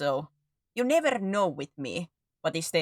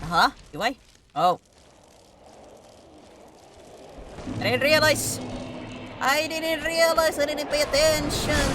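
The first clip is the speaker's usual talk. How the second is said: surprised; 9 semitones higher than usual. Retold or imitated. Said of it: "I'm like".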